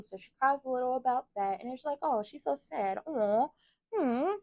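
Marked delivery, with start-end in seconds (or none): 2.0-4.3 put-on higher cooing baby-talk pity; mock pity. Teasing sympathy for Lulu crying, high baby talk. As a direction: coo at her like a sad little child.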